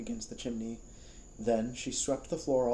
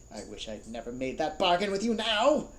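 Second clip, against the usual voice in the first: ordinary delivery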